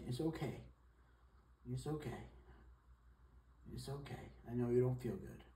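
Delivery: soft, hushed reassurance; gentle soothing. Real, not acted. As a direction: comfort a sick pet softly. Comforting a sick cat.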